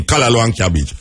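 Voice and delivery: usual speaking voice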